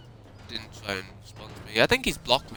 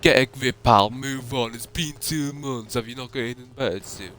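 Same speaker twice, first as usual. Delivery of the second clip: imitating a dismissive mocking jab, a bit louder; retold dismissive taunt, louder at the start; mocking Contender; 3 semitones higher, 5 dB louder than usual. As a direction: sneer it like brushing someone off. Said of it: "the first thing Contender said".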